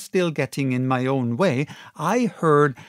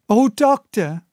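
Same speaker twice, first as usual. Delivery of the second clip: cried; 8 semitones higher, 6 dB louder than usual. A narrator's reading. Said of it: "we cried".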